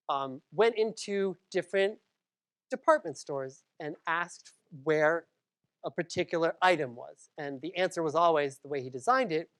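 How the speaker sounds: usual speaking voice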